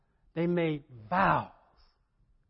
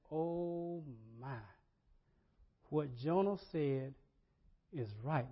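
soft, awed breath of a line; hushed awe; 2 semitones lower, 10 dB quieter than usual. Retold or imitated. Sailors stunned as the sea calms, hushed. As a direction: gasp it out quietly in wonder.

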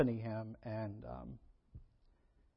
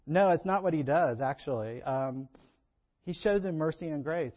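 normal talking voice

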